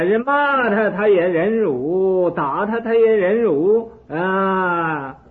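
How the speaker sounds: loud high mocking disbelief, slowed on the ends; incredulous king shouting scorn; scornful king. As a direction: ridicule him as a liar. King scoffs at hermit's claim.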